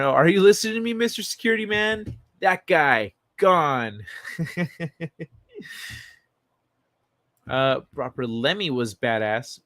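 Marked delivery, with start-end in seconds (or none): 0.0-4.0 louder, high-pitched mock demand; hammed-up challenge to a security guard; heightened comic quote. Same comic quote, repeated more emphatically. 4.1-5.2 soft chuckle after a bit; real laugh. Real, not acted.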